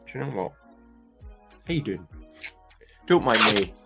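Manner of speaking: normal talking voice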